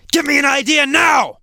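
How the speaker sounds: high, loud demanding shout; harsh tone. Shown as a demonstration. Teacher demonstrates harsh self-talk for students, harsh shout. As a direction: shout it roughly like an impatient order. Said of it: "I'm speaking in a harsh way".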